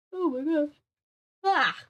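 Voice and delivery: talking through a yawn. Real, not acted. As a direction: yawn out the words. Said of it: "I don't know why I'm yawning".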